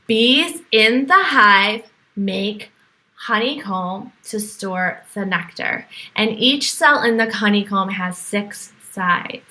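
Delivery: plain reading voice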